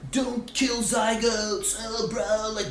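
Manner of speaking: high mocking bro voice. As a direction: parody a pushy dudebro protester. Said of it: "go out into the streets and be like".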